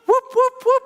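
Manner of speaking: very high whooping rotor noise. Acted. Teacher makes rotor sounds for preschoolers, very high whoops. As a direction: make the rotors sing.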